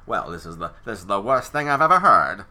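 a hint of stilted Mid-Atlantic, laughing at the end; mock old-movie Mid-Atlantic voice, giggling; Mid-Atlantic accent. Shown as a demonstration. Movie critic mocking the Mid-Atlantic accent, with a light put-on Mid-Atlantic voice. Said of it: "that, like".

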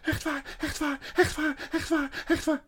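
tiny, very high squeaky chant; frantic young squirrel. A narrator's reading. Young squirrel insists to the witch, squeaking frantically. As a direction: squeak it over and over in panic. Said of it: "raakte een van de jonge eekhoorntjes helemaal de kluts kwijt".

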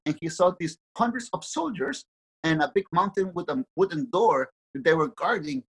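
ordinary delivery

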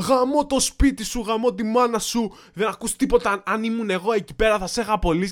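loud, high, cursing tirade; shouting angry customer. Retold or imitated. Customer swearing at a new waiter on the phone, angry shouting. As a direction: yell abuse down the phone. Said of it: "αλλά με φώναζε".

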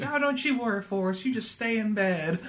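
high, soft motherly voice; mock Southern mother, soothing her son; sweet mama voice. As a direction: coo like a doting Southern mom. Voicing Forrest's mama.

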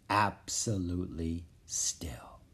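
slow, soft whispered narration; hushed whisper. A narrator's reading. Narrator as the spinning treehouse stops, slow whisper. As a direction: whisper it slowly, leaving space between the words.

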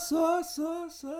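high, fading echo; echo effect. Pretend. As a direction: echo the syllable back. Echo gag on Bom Sucesso.

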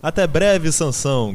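high, loud call of goodbye; shouted farewell. A narrator's reading. Animals bid their friend farewell, shouting. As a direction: call out goodbye across the yard.

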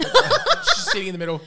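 high, laughing repeat. Real, not acted. Laughing at the premier in the middle seat. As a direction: say it through a laugh.

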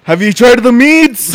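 high, loud mock salesman line; hamming up a pushy host; mock sales pitch.

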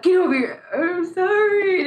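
hammed-up crying apology; mock bawling. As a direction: wail it like a crying kid caught. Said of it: "he'd be like".

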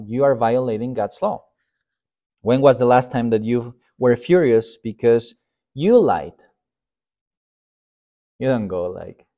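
normal talking voice

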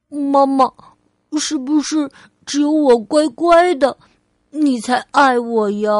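put-on little child asking Mum for love; little girl Ali, unsure. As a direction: play a small child seeking reassurance. Child character asks mother.

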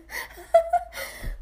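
very high, loud squealing laugh; real uncontrolled cackling laughter; laughing fit. Real, not acted. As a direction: burst out in helpless giggles. Cracks up at own slip.

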